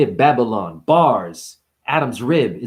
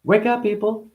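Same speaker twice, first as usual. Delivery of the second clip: mock alarm; 9 semitones higher than usual. Mock conspiracy call.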